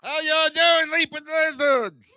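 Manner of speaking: opening crowd shout-out; high crowd greeting. Real, not acted. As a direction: call out to the audience.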